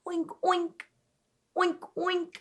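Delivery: oink. Shown as a demonstration. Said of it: "this one is super cute".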